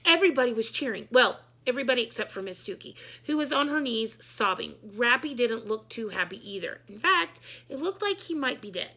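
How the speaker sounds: ordinary delivery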